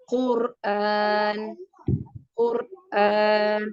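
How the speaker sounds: Qur'an with a heavy ra. Shown as a demonstration. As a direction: say Qur'an with a thick ra. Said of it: "So this is heavy".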